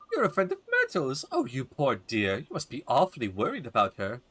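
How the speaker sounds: put-on swooping old lady voice; gossipy old woman, sympathetic. Old neighbor pities Myrtle's friend, fussing sympathy. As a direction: fuss over them like an elderly neighbor.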